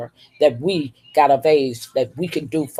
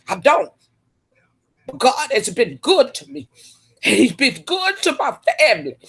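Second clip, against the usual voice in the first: real tears breaking through a higher voice; crying; 9 semitones higher than usual. Real, not acted. Woman crying with gratitude on her live stream. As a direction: thank God while the tears come. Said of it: "I don't like to cry on my live streams".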